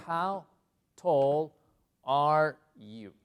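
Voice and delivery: loud, separated words; word-by-word model. Shown as a demonstration. Teacher showing how English asks height, word by word. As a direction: pause after each word, firmly.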